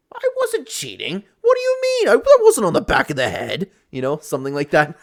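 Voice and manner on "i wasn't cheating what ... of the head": whiny denial. Retold or imitated. Chandler denying cheating after the fight, high whiny protest. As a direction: squeak out a defensive denial.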